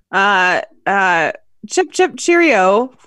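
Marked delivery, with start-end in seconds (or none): 1.7-2.9 a cheeky fake British accent; mock British accent. Asked for final thoughts, signs off in British accent. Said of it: "just as good as my American accent".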